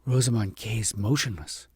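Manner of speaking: ordinary delivery, nothing marked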